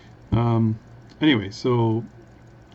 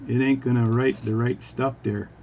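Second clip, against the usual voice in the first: usual speaking voice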